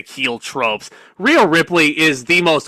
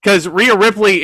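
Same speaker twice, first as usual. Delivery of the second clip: usual speaking voice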